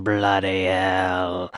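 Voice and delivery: slow, drawn-out mock British Bloody hell. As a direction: stretch it out like a Brit would. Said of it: "People saying".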